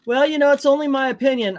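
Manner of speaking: high, fast mock whine; mocking other channels. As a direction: mimic other YouTubers' whiny disclaimer. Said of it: "they go".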